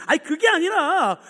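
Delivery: high, insistent mock holler; retold shouting. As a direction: yell past the manager's apology. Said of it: "하도 소리 지르니까요".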